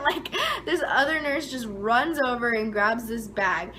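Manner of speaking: usual speaking voice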